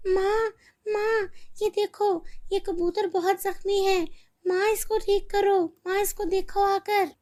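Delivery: put-on high, tearful child pleading; begging mom to help the bird; girl crying over a wounded pigeon; distressed girl.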